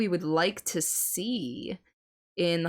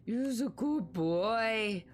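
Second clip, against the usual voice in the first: dog praise voice; 3 semitones higher, much slower than usual. Pretend.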